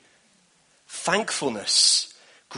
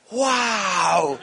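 high, loud, wondering wow; child's wow; 5 semitones higher, 4 dB louder than usual. Retold or imitated. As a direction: belt it out like a boy amazed by the sky. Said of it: "going, like this".

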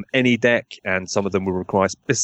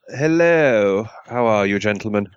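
plummy, upper-class English greeting; posh voice. Acted. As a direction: put on a posh English gentleman voice. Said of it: "Sorry, that was a really posh voice".

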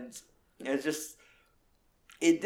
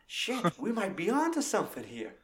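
higher hammed-up gasp of realisation; mock surprise; 6 semitones higher, 4 dB quieter than usual. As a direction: play the dawning "we've got him" moment lightly. Voicing henchman's realisation.

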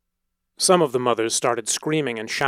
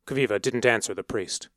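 usual speaking voice

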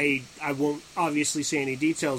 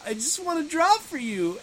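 meek, high-pitched little voice; quiet, reserved artist squeak; Javis; 11 semitones higher than usual. Acted. Mocking the reserved artist pleading to draw, meek high voice. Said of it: "sitting there all quiet and reserved".

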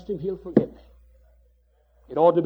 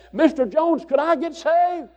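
pleading sinner; 9 semitones higher than usual. Acted. Sinner calling from the back.

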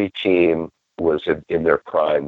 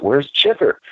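eager call; 9 semitones higher than usual. Retold or imitated. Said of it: "I'm like".

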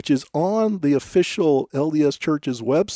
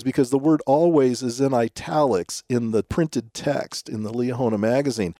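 ordinary talk, nothing marked

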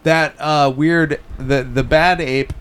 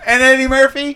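incredulous; 11 semitones higher, 4 dB louder than usual. Real, not acted. Incredulous question.

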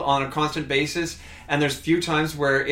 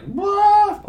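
surprised; 5 dB louder than usual. Retold or imitated. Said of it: "I was like".

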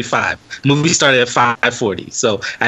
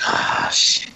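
hushed, barely voiced curse; muttered dread; 5 dB quieter than usual. Moviegoer sensing a fight brewing, hushed dread. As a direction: say it under your breath, quietly re-enacted.

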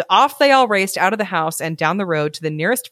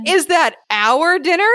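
high, whiny complaint from a spoiled dog; 10 semitones higher than usual. Acted. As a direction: whine it like a pampered pet. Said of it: "putting on my best whiny voice".

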